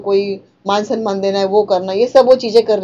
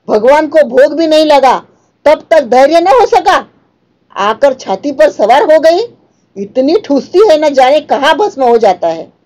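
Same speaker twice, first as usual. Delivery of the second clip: put-on higher and louder, lashing out at the old woman; a furious daughter-in-law berating, voice raised; scolding outburst; 4 semitones higher, 4 dB louder than usual. Rupa scolding old Kaki for sitting by the pan, loud angry rebuke. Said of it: "उन्हें दोनों हाथों से झटक कर बोली".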